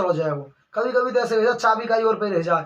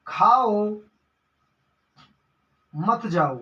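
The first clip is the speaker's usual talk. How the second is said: खाओ drawn out, long pause, then मत जाओ together; pause after खाओ; much slower than usual. Shown as a demonstration. Teacher showing the wordplay example, pause after खाओ. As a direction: break after खाओ, keep मत जाओ as one. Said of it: "वक्र का अर्थ होता है घुमावदार".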